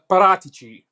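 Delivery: Paratici with the final ci as "chee"; correct Italian pronunciation. Shown as a demonstration. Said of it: "how do you pronounce the name correctly".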